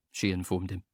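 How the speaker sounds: ordinary delivery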